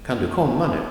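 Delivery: "kan du" short, "komma" emphasized, "nu" unstressed; stress on komma. Shown as a demonstration. Teacher summing up the rhythm, stress only on "komma". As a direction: lean on "komma" and drop "nu" lightly. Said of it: "nu is also not much emphasized".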